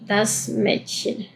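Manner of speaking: slow, soft "Das Mädchen" with an open ä; German Mädchen. Shown as a demonstration. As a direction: say "Mädchen" as metchen, carefully. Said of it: "tenemos la palabra Mädchen".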